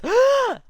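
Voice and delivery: high, loud sucked-in gasp; sharp inhaled gasp. Retold or imitated. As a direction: imitate a huge shocked breath in. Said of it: "he breathes it all in".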